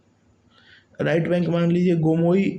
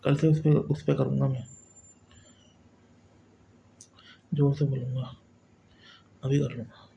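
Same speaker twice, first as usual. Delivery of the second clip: quiet aside; 5 dB quieter, faster than usual. Real, not acted. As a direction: drop the volume for a private aside. Aside about speaking louder later.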